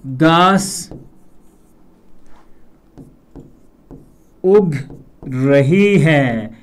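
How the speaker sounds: each word set apart by long pauses; slow dictation. Shown as a demonstration. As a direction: dictate slowly, word by word, like a teacher. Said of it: "रहा है नहीं बोलेंगे". (धीमी गति से, हर शब्द अलग-अलग)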